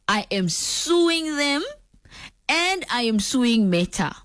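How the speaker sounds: slow, high, drawn-out threat; mock-serious threat. Real, not acted. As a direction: stretch out each threat for emphasis.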